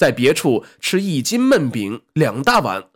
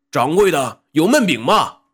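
yelling across the room; 4 semitones higher, 4 dB louder than usual. Acted. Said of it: "冲他大喊".

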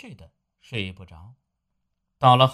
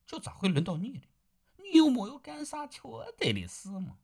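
softer wife's voice, dropping to a hush then rising; wife reassures her frightened husband; soothing wife; 3 semitones higher, 7 dB quieter than usual. Acted. Wife comforts, stroking his back. (妻子轻声安慰，时而耳语)

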